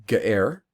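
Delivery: slight breathy break inside ge'er. Shown as a demonstration. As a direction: split ge'er just before the root. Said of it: "if there's that bit of break before the".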